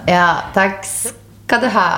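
slow, dry sarcastic thanks; mock sarcastic thanks. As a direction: drag it out sarcastically. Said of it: "Jeg ba".

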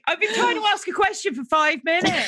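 high-pitched comic complaint; mock exasperation. Real, not acted. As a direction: protest at being derailed by the others. Host mock-complains about constant interruptions.

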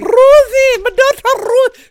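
high, loud, drawn-out mock presenter; Lorraine Kelly. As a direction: gush over the name, hammed-up and slow. Impersonating Lorraine on her daughter.

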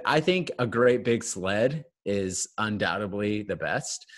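usual speaking voice

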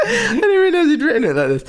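crying with laughter as you read; laughing so hard the words squeak; helpless laughter. Real, not acted. Reader cracking up mid-read.

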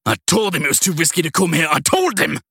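enraged yell, I told him hammered; furious shout. A narrator's reading. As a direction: blow up, shouting the blame. Cornered criminal rages at his arrest.

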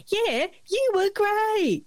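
high, drawn-out mock praise; over-encouraging reassurance. Film crew humouring an actress after her take, over-encouraging.

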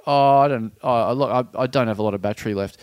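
low, evasive excuse; reluctant woman. Retold or imitated. Cornered woman dodging the weirdo's request, low evasive voice. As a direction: imitate a stranger backing out, voice dropped. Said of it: "And the woman's like".

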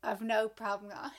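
ordinary delivery

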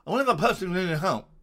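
fast, high, loud mock-posh reporter; posh newsy guy. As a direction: rattle it off as a posh newsman. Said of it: "I'm trying to be a newsy guy".

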